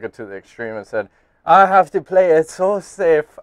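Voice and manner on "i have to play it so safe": slow, louder mock French-Canadian accent; a half-hearted French-Canadian impression of GSP; GSP's French-Canadian accent. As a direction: drag it out like a French-Canadian fighter. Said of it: "I can't do the fucking accent".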